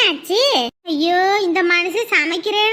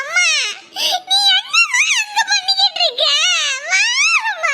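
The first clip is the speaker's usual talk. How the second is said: high wailing sob for mum; crying child; 11 semitones higher than usual. Acted. Boy sobbing to his mother on the phone to come back. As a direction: beg through tears, voice high. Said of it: "ஏன்டா அழுவுற இப்படி?".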